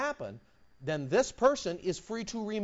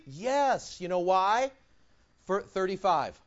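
ordinary talk, nothing marked

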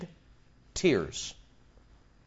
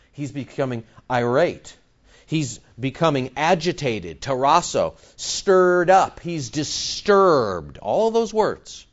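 ordinary talk

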